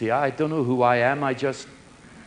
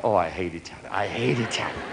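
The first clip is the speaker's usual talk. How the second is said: inner voice; 9 semitones lower, 7 dB quieter, faster than usual. Retold or imitated. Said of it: "And inside".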